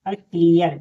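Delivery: "क्लियर" said loud as the example word; model word clear. Shown as a demonstration. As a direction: punch "क्लियर" out for the class. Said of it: "यहाँ अ भी हो सकता है". (क्लियर शब्द ज़ोर से)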